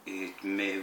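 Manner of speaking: normal talking voice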